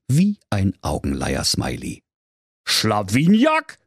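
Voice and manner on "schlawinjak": slow, high outraged repeat; raging king. Acted. King scoffing at the servant's name, high and slow. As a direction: spit the name out in mock fury. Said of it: "tobte der König".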